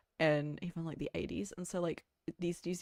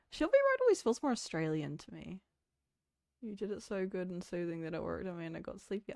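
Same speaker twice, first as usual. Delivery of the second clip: ordinary talk, nothing marked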